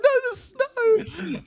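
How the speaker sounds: put-on very high, very slow child's wail; whiny little son. Father imitates his small son wailing for snow, very high and slow. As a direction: drag it out like a toddler begging. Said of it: "He's like".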